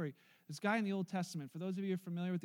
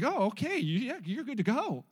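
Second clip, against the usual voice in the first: a louder, breezy traffic cop waving him through; mock cop voice; 3 semitones higher, 7 dB louder than usual. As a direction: play the easygoing officer, a bit louder. Pastor voices a traffic officer.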